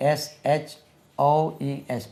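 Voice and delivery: ordinary delivery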